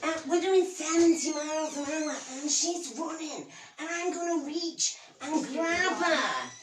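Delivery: hammed-up Scottish storyteller; a comic's amateur Scottish brogue; bad Scottish accent. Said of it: "my, uh, very bad, um, Scottish accent".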